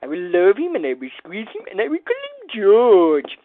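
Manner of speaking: a playful cartoon cuddle line; hugging-pet quote. Builder naming Baby Yoda George, mock cartoon hug voice. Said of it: "How about George?".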